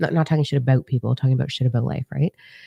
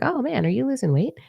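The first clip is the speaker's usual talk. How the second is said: sweet, much higher mock-friendly question; lightly voiced chirpy compliment; playful fake-nice pitch; 10 semitones higher, 3 dB quieter than usual. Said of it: "one day I was like".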